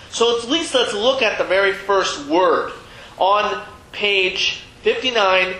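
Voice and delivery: ordinary delivery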